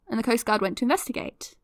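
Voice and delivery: normal talking voice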